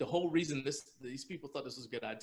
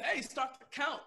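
mock yell; 13 semitones higher than usual. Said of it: "So my coach is yelling".

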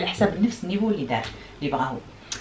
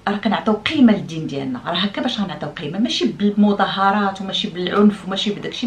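ordinary talk, nothing marked

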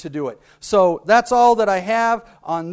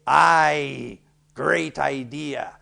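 pirate voice; 4 semitones lower, much slower than usual. Shown as a demonstration. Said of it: "you can look at him and say".

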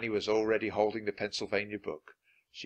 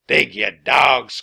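loud, slow, stretched command; captain's cry; 3 semitones higher, 13 dB louder, much slower than usual. A narrator's reading. Captain Bones orders his men on, crying out loudly. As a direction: bellow it at a lazy crew. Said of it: "cried Captain Bones".

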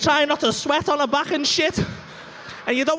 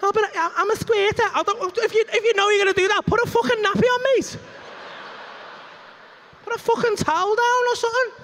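mock whiny woman; 9 semitones higher than usual. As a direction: whine it like a sulky girlfriend. Mocking woman's excuse and retort.

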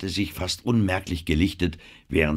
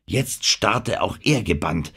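usual speaking voice

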